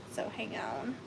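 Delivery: under-the-breath whisper to herself; whispered aside. Real, not acted. Whispering to herself while hunting for her dog's photo. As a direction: murmur it to yourself while searching. Searching phone for dog photo.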